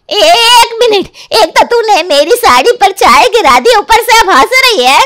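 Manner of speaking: loud, high furious scolding; angry scolding. Acted. Aunt-in-law scolds the bride for spilling tea, furious and loud. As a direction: scold the new bride hotly.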